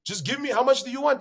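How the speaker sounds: a hint of a friendly hustler; playful offer. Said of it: "you tell them".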